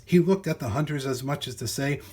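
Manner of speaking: plain reading voice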